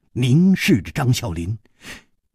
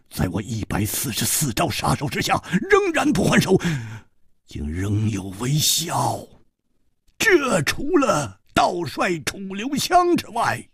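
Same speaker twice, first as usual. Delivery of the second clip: cold killer, insistent; 8 semitones higher than usual. Acted. Killer argues who his opponent must be, intense cold insistence.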